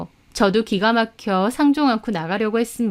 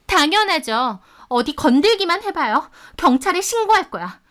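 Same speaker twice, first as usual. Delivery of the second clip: high, threatening retort; defiant; 7 semitones higher than usual. Acted. Pregnant rival threatening to report her, defiant. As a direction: dare her, pitched well up.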